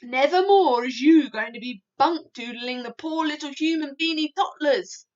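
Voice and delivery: loud stern threat; loud scolding giant. A narrator's reading. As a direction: threaten the nightmare.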